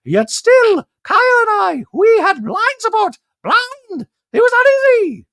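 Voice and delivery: very high, loud, squeaky voice; Dorit impression. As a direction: squeak it like a posh socialite.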